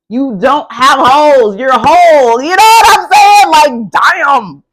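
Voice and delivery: loud, emphatic hype rising in pitch; fired up. Real, not acted. Reader hyping Gemini up as whole, fired up. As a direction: belt it out, building to a high peak. Reader hyped up by her reading.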